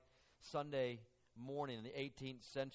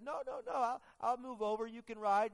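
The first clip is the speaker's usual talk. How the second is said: lightly voiced eager lady, higher; imitating the lady; 10 semitones higher, 6 dB louder than usual. Preacher voicing the lady offering her seat. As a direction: insist kindly in a high lady voice.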